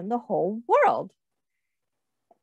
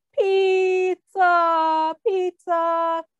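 high, loud sing-song cry of pizza; street vendor's call; 7 semitones higher, 3 dB louder, much slower than usual. Acted. Pizza carrier calling out through the city streets, loud sing-song cry. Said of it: "ran through the streets of the city singing to announce".

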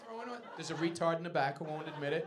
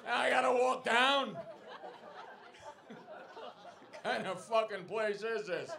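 mock complaint; 7 semitones higher, 7 dB louder, slower than usual. Real, not acted. Walking drunk lady down stairs.

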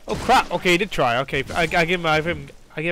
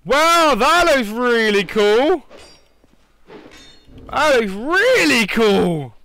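high, loud, slow wow; thrilled, drawn-out admiration; excited awe; 5 semitones higher, 5 dB louder, slower than usual. Real, not acted. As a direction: marvel at the sword. Admires a weapon.

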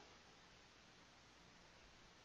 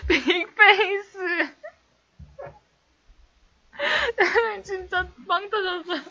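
laughing; 9 semitones higher, slower than usual. Real, not acted. Streamer cracking up at chat.

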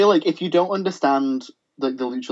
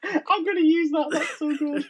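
high, soft giggling words; real soft laughter; laughing; 13 semitones higher, 7 dB quieter than usual. Real, not acted. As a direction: giggle while praising the line. Laughing at joke.

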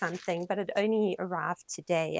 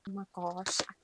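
ordinary talk

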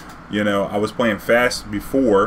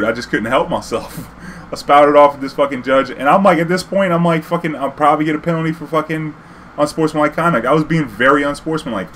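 ordinary talk

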